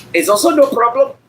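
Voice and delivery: sing-song English with an Indian accent. Shown as a demonstration. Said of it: "do an accent from somebody from India".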